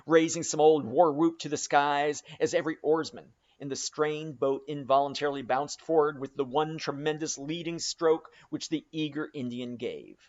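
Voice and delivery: ordinary delivery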